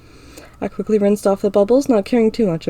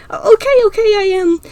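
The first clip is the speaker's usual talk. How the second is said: put-on high, giggly girl giving in; 13 semitones higher, 6 dB louder than usual. A narrator's reading. Ira caving to the tickling, in a high, giggly voice. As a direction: squeal it out as she surrenders.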